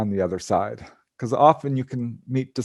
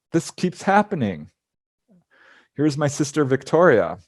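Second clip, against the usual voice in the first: ordinary talk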